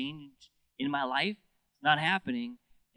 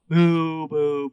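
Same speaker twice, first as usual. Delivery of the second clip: loud drawn-out boo like a stadium crowd; mock stadium boo; 3 semitones higher, 11 dB louder than usual. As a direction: boo the ump from the stands. Crowd booing a bad call.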